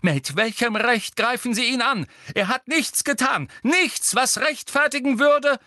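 high, loud, commanding shout; stern professor shouting across the dark; McGonagall calling out. A narrator's reading. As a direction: order them off in outrage. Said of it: "rief Professor McGonagall durch die Dunkelheit".

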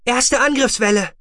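high, loud battle report; woman's voice. A narrator's reading. Female tracking officer announces the attack wave, high and loud. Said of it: "eine Frauenstimme".

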